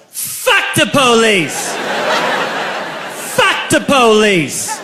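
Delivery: high, drawn-out rallying yell; big slow party-crowd holler; drunken yell. Retold or imitated. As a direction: holler it long, falling from a high start. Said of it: "I yelled".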